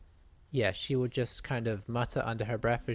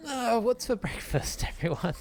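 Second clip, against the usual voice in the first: high, stretching wake-up voice, mock carefree; waking up; 11 semitones higher, 3 dB louder than usual. Eska joking on waking beside a dragon, high mock-sleepy cheer. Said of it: "Eska stretches and says".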